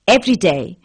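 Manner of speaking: plain reading voice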